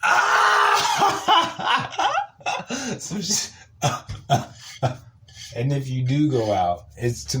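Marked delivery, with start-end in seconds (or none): 0.0-2.9 a sudden high laughing shriek. Real, not acted. Comedian cracking up at his own story, high laughing shriek.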